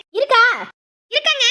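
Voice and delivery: high, loud checking call. Acted. Wife asking husband whether he found it, calling out. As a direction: call the question across to him.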